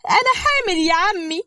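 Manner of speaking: a wronged wife laying it on thick; fake tearful wife. Mai complaining to her father-in-law, high fake-tearful whine.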